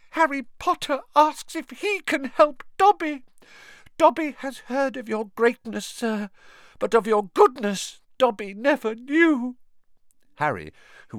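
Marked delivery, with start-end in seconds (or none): 0.1-9.6 high squeaky elf voice, much higher than the narrator; an awed little elf, squeaky and eager; house-elf Dobby. A narrator's reading. Dobby praising Harry's greatness, high squeaky elf voice.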